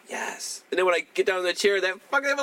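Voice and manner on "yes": small unvoiced yes; whispered yes. Patient answering the receptionist in a tiny whisper. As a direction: barely breathe the word out. Answering dentist's call nervously.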